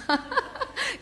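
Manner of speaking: high burst of real laughter; sudden laugh. Real, not acted. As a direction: laugh despite the painful memory. Laughs recalling the teacher.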